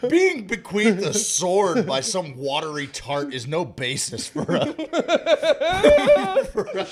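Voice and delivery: high, slow quote breaking into laughter; Monty Python quote. Retold or imitated. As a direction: deliver the line grandly, then crack up. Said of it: "And he's like".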